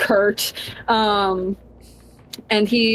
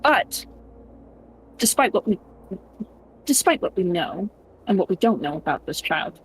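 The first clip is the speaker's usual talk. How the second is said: soothing reassurance; 3 semitones lower, 4 dB quieter than usual. Acted. Prince soothing the frightened boy, soft and calm. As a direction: gently talk the scared kid down. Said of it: "he's just like".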